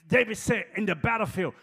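loud, high, impassioned sermon climax; shouting the Psalm from the pulpit; full-force preaching. Acted. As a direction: whoop and thunder at the congregation.

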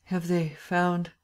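slow, halting question; groggy. A narrator's reading. Waking Lupin asks the doctor for news, groggy. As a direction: labour the words out with a gap. Drugged Lupin slowly coming round.

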